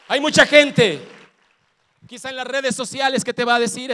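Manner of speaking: loud fervent preaching; full-force preaching. Real, not acted. Pastor loudly warning the congregation about doubters. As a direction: preach it hard to the crowd.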